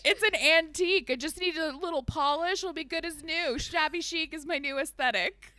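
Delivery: campy decorator voice; fussy Silent Brother. Retold or imitated. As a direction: preen over a shabby antique. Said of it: "He's like".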